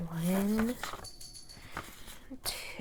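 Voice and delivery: a soft whispered number; whispered count. Real, not acted. Counting pieces under her breath.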